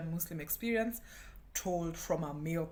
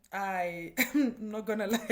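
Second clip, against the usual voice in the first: laughing; 3 semitones higher, 6 dB louder than usual. Real, not acted. Laughing while admitting.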